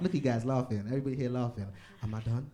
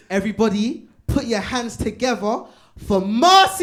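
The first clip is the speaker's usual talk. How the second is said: host announcement; 9 semitones higher, 9 dB louder than usual. Real, not acted. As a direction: bring her on like a ring announcer. Host introducing next comedian.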